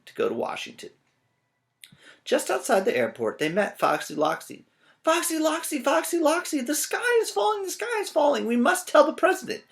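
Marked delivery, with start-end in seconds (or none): 5.0-9.6 very high, loud panicked yell; yelling birds. Acted. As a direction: shout the alarm at the fox. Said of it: "yelled Chicken Licken".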